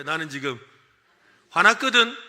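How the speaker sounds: loud playful anger; loud mock angry. Shown as a demonstration. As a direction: shout it like you mean it. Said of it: "해볼까요?".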